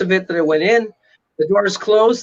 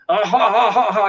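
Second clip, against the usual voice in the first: imitated evil cackle; 3 semitones higher than usual. Unseen evil echoing through burning church, imitated cackle. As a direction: imitate a demonic laugh, beat by beat. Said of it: "I hear this laughing and cackling".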